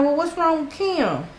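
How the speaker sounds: high, urgent retold question; alarmed question. Retold alarm at cousin's news.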